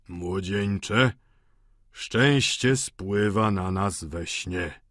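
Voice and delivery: slow, hollow drugged voice; głuchy głos. A narrator's reading. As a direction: drag it out dully as the drug takes hold. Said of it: "rzekł głucho". (głucho i bardzo powoli)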